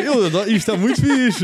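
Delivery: high, loud hammed-up delight; fake gushing. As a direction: overplay the joy, forced. (entusiasmo forçado e agudo)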